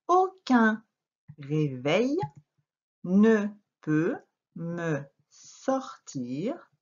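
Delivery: very slow "Aucun réveil", each word set apart; word-by-word reading. Shown as a demonstration. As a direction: read in separate syllables with long pauses to "sommeil". Teacher reads sentence word by word.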